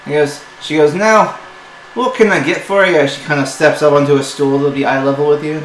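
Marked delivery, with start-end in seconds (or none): 0.9-3.1 higher and louder, turning polite; sweetened shopkeeper. Acted. Dwarf mistress greeting the party, higher and brighter. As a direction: switch to a sales voice.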